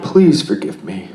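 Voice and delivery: calm, unhurried guided meditation voice. Acted. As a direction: guide them gently into stillness.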